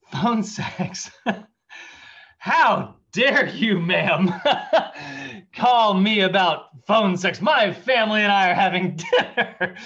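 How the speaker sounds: loud, high, slow mock indignation breaking into laughs; mock outraged dad. Dad pretends to mishear the telemarketer, in loud mock outrage. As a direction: play the offended father, laughing through it. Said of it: "my dad interrupts her, and he goes".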